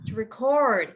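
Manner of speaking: "record" as re-CORD; stress on second syllable. Shown as a demonstration. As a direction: land firmly on the end of "record". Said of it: "if it's a verb, then you would say".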